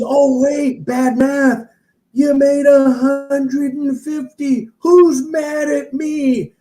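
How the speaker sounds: mock fake-humble preacher bit; guru backtracking. Guru joking to the crowd about bad math, hammed-up and loud. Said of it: "And he goes".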